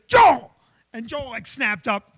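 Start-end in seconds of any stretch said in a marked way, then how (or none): 0.1-0.5 sharp high shouted call, much louder; boss barking a name. Retold or imitated. Boss shouting for his employee in a meeting, loud and high. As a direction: yell his name across the meeting room. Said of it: "Billy goes".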